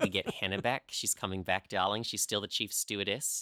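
put-on Australian accent, darling-style. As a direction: share the gossip in a playful Australian accent. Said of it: "which is my Australian accent".

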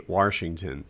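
Washington said as "Warshington"; intrusive R. Retold or imitated. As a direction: put an R into the first syllable of Washington. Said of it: "They put a crazy R in there".

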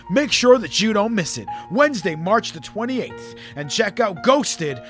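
smooth promo voiceover read. Acted. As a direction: read it like a book advert. Ad read over music.

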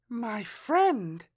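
put-on high child voice; little girl. A narrator's reading. Girl tells Matthias about her friend, high child voice.

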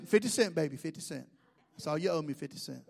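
low, soft, crooning cashier voice; imitated cashier. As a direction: drop low and coax it out.

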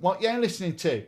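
high, loud Nottingham accent. Shown as a demonstration. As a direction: say it like a Nottingham lad. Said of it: "in a Nottingham accent".

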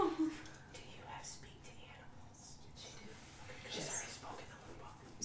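whispering. Real, not acted. Whispered aside at the table.

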